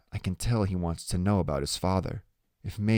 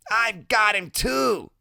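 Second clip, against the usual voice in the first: very high, slow exclamation; Loretta, delighted; 3 dB louder, slower than usual. Acted. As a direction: call out a discovery in Loretta's voice. Loretta recognizes the other boy.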